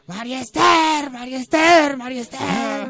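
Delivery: high, loud chant, easing off at the end; multitud que grita. Acted. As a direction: chant it like a crowd answering. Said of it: "todos gritan". (coro de multitud, agudo y fuerte)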